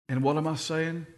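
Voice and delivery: ordinary talk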